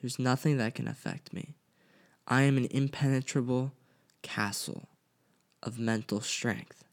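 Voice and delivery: quietly steady yourself like a calm mantra; a low, calm inner mantra. Shown as a demonstration. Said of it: "you have to stop, clear your head".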